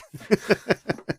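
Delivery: high, loud burst of laughter. Real, not acted. Laughing over naming Hercules in New York, real laugh.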